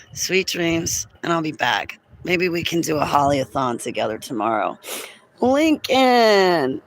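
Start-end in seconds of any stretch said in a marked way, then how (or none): 5.4-6.8 calling out. Real, not acted. Greeting a viewer by name.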